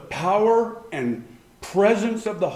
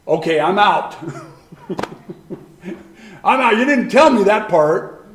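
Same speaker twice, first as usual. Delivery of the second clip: laughing mock refusal; 5 dB louder than usual. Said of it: "mock reaction to suffering".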